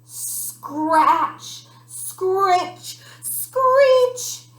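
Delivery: drawn-out scrapes rising in pitch and volume; building from scratch to high loud screech; imitated scratching noise. A narrator's reading. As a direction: stretch each word like claws on glass. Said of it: "What's that noise?".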